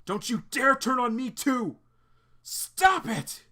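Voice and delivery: very high, very loud threatening scream; screaming rage. Acted. John raging at Adrian.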